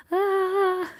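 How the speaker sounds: high, loud, held "ah"; exasperated little cry. Real, not acted. Crocheter untangling yarn for viewers, exasperated cry. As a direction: let out a high, drawn-out wail.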